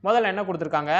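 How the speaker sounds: ordinary talk, nothing marked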